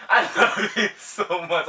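I-love-you pushed out through laughter; real giggly, pleading affection; laughing affection. Real, not acted. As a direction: gush affection while cracking up. Affection through laughter after prank.

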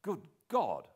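startled exclamation; cried out. A narrator's reading. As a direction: blurt it out in dismay. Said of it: "I cried".

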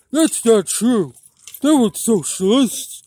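hammed-up contradicting voice; mock objector. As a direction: imitate someone indignantly objecting. Imitates an objector, then answers.

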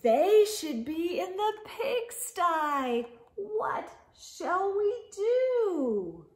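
sing-song the refrain for little ones; storybook refrain. A narrator's reading. Reader asking the children what to do, sing-song.

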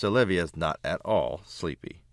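usual speaking voice

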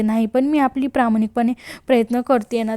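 ordinary delivery

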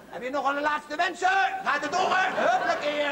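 ordinary talk, nothing marked

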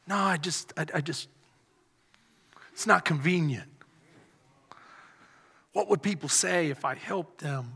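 low, halting, mock reluctance; hesitant excuse. Imagined lifeguard refusing to help, low hesitant excuse. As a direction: hedge and stall in a low voice.

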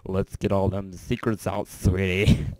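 very loud hammed-up coaxing; mock coaxing. Streamer coaxing secrets out of viewers, loud mock coaxing. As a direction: belt it out with teasing relish.

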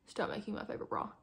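breathy whispered plea, no voice; hushed mock despair. Real, not acted. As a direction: whisper it like a quiet begging. Pleading aside about the bra.

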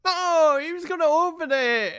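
loud, high protest at the screen; frustrated shout. Real, not acted. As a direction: yell it in disbelief. Game cuts scene before code.

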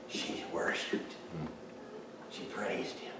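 soft near-whisper after the shouting; hushed. Acted. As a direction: drop it to a whisper. Sudden drop after preaching.

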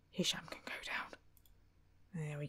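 breathy whisper, barely there. Real, not acted. Manager secretly demoting a player, playful whisper.

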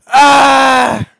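loud drawn-out yell; frustrated yell. Real, not acted. Gamer cursing a setback, frustrated yell. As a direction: let out a loud wordless yell.

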